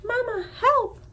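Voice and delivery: high squeaky cry for help; a tiny gosling squeaks in panic; high, loud squeaky plea; squeaking gosling. Acted. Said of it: "squeaked Bright Bill".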